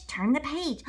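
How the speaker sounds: ordinary delivery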